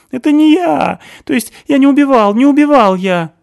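high, loud hysterical accusations; panicked screaming; hysterical shouting. A narrator's reading. Stasik screaming denial.

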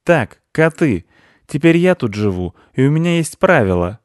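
bossy squirrel. A narrator's reading. Squirrel gives the cats her rules, bossy. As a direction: lay down the law to the cats. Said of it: "белка продолжала распоряжаться".

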